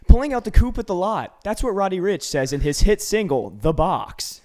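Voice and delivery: loud, high news anchor read; newscaster voice. Retold or imitated. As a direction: announce it like a TV newscaster. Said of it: "The box in newscaster voice".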